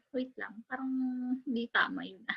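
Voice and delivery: soft, breathy self-doubt under the breath; real self-doubting whisper; unsure whisper. Real, not acted. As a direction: whisper it like you just caught a mistake. Doubting her own pronunciation.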